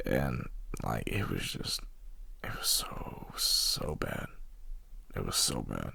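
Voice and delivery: very low and soft, almost whispered; hushed disbelief. Real, not acted. Recalling a terrible wipeout, hushed disbelief. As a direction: sink to a hushed murmur, with pauses. Dwells on how bad it was.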